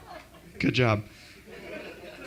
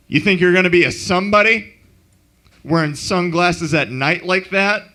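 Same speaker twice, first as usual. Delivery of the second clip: a lightly voiced scolding father, louder; 5 semitones higher, 6 dB louder than usual. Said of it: "impression is of, uh, [name]'s dad".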